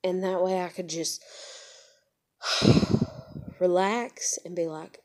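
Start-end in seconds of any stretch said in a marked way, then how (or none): 2.4-3.5 long audible exhale; sigh of relief. Acted. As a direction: let out a big relieved sigh. Acting out being able to relax.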